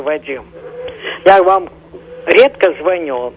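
usual speaking voice

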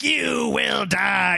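slow, drawn-out demonic threat; possessed old woman. Retold or imitated. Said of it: "an old woman in Army of Darkness would be like".

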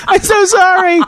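high, loud mock-whimpered apology; pleading apology. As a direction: imitate your groggy self begging the nurse's pardon. Pleading with the furious nurse.

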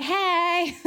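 lifted, breezy mock greeting; casual hey. Jesus appearing through the wall, breezy mock greeting. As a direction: pop in with a casual hi. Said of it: "was like".

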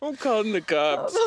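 mock threat. Mock threat amid shared laughing fit.

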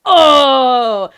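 loud high shocked oh; a room gasping in shock. Retold or imitated. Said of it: "everyone in the room goes".

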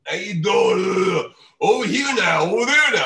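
mock Dice Clay bravado; Andrew Dice Clay. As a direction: belt it out like a brash Brooklyn comic. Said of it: "Andrew Dice Clay calls up".

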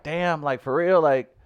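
lightly voiced jealous cast member; incredulous cast member. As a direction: needle Larry with disbelief. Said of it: "part of you have to be like".

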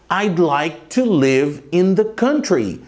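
slow, clear model sentence. Shown as a demonstration. Teacher reading the example slowly to students. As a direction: say each word slowly for the learner. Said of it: "Olha um exemplo".

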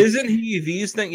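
hammed-up admiring question, drawn out; mock admirer. Parodying girls who spell out a character's traits, mock gushing. Mocking clumsy expository movie dialogue.

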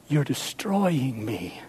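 low, slow, soft lament; a hurt believer crying softly. Acted. Believer calling out to God in quiet pain. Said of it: "you may be calling out".

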